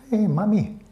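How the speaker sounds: high-pitched attempt at an Aussie kid; Australian kid. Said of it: "Aussie kid saying".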